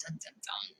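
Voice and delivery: ordinary delivery, nothing marked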